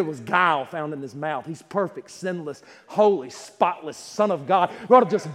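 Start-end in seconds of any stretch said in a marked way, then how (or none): none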